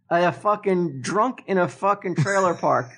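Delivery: ordinary delivery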